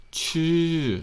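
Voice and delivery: chu high and falling with a tightened throat; high falling chu from a tight throat. Shown as a demonstration. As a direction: squeeze the throat on chu and drop it. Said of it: "you tighten your throat up right here".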